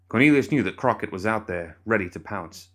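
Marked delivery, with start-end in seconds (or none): none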